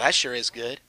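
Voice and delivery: usual speaking voice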